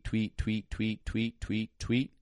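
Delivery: high, repeated tweets; imitated whistle blasts. Trainer mimicking whistle blasts at a dog, high repeated tweets.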